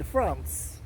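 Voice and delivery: France said low and soft with a mock French nasal vowel; a clumsy try at a French France; France, lightly hammed-up French accent; bad French accent. Shown as a demonstration. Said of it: "that is a bad French accent".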